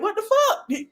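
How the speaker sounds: high squeaky disbelieving what-the; mimicking her friend's outrage with a high laugh; shocked mock outburst. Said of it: "Sherelle is like me. Like".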